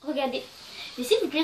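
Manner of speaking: ordinary delivery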